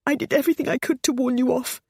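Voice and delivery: high, tearful pleading on everything; mother pleading tearfully, everything high. A narrator's reading. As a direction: plead through tears. Tearful take.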